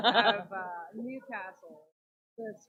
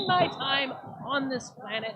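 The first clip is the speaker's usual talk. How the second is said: mock grand quote; 7 dB louder than usual. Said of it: "says Coco".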